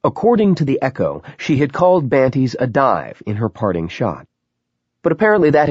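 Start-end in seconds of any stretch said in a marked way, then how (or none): none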